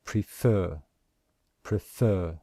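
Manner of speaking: prefer, prefer, with the second syllable stressed. Shown as a demonstration. Teacher modelling the stressed syllable. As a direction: repeat prefer, hitting FER both times. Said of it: "the syllable which we stress".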